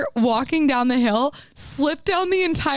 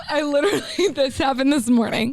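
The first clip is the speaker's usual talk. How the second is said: ordinary talk, nothing marked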